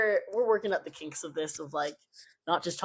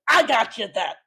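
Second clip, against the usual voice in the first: louder, excited outburst; blurted mock confession; 3 semitones higher, 7 dB louder than usual. Imagined parent spoiling Santa for the kids, loud mock blurt. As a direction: blurt it out as if unable to hold the secret. Acting out blurting a gift secret.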